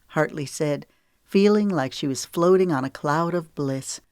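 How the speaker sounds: ordinary delivery, nothing marked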